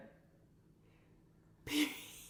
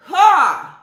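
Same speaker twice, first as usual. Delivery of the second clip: loud scoffing ha; 10 semitones higher, 14 dB louder than usual. Real, not acted. Scoffs loudly.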